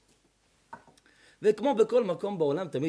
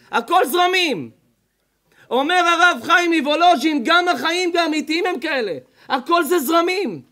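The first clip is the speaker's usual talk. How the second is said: louder, higher sermon voice; forceful preaching; 7 semitones higher, 7 dB louder than usual. Acted. The rabbi preaching to the congregation, forceful sermon voice. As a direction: drive the point home from the pulpit.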